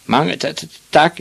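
high, broken-up stammer; jolted, stuttering child voice; hiccuping thanks. A narrator's reading. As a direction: hiccup through the words as a small boy. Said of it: "hikkede Ivan Olsen".

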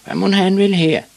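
high, loud mocking question; jeering shout. A narrator's reading. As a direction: call out as teasing schoolboys. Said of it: "råbte nogle af drengene".

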